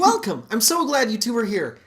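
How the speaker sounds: high, bright pastor greeting; upbeat youth pastor. Acted. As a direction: welcome them like a cheery youth pastor. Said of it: "Yep, perfect".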